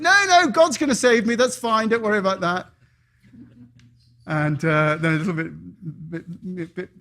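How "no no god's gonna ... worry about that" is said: high, louder breezy brush-off; stubborn man refusing help; confident refusal. Acted. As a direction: wave the rescuer away.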